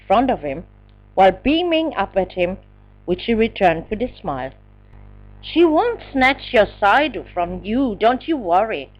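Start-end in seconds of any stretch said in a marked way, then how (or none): none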